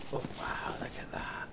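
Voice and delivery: hushed amazed whisper. Real, not acted. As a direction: breathe it out in awe. Awed at something seen.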